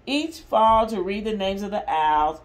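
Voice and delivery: lively, expressive picture-book reading; mother reading a Disney story to restless kids, lively and expressive; reading to children. Acted. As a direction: read it out big for the little ones. Reads story aloud to kids.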